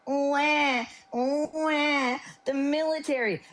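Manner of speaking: high whiny wah-wah mockery; mocking whiny crybaby. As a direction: whine it like a crybaby. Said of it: "be like".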